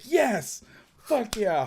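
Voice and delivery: high excited whoop; retold cheering. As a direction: cheer like a team on the plane. Team cheering movie announcement.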